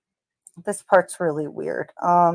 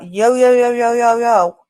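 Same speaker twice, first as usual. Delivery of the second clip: higher mock yell on rapid yos; retold girl's yell; 4 semitones higher, 3 dB louder than usual. Narrator retelling a girl who yells out yo, mild mock yell. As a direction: call it out, slightly raised, as a retold yell. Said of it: "a girl yells out".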